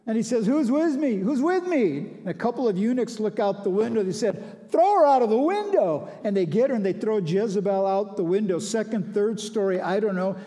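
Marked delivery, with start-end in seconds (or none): 0.5-2.0 high, loud call to anyone on his side; rallying shout. Retold or imitated. Jehu calls up for allies: loud, high rallying shout. 4.7-6.1 high, loud order barked upward; commanding shout. Retold or imitated. Jehu orders the eunuchs to throw Jezebel down: loud, high command.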